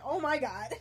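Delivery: laughing. Real, not acted. Laughing at misreading.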